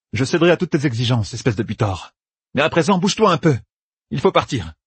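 high, fast, bossy scolding; exasperated urging. A narrator's reading. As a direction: hurry him along in a high, quick voice. Flustered woman ordering champion to move.

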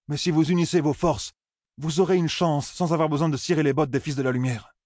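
high-pitched, insistent advice to a crowd; Perrine, urging. Acted.

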